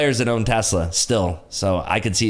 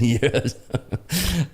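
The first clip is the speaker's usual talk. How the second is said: slow London-accent imitation breaking into laughter; half-failed gruff Londoner impression; Jason Statham; 3 semitones higher, much slower than usual. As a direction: try the London growl while laughing. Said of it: "It really did sound like Jason Statham".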